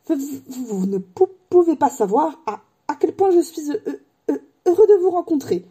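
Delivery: high, halting voice with repeated syllables; nervous stammer. A narrator's reading. Nervous professor meeting Harry, high stammering. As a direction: stumble over word after word. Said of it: "balbutia le professeur en saisissant la main".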